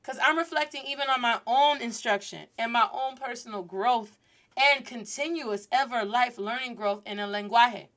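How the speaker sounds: usual speaking voice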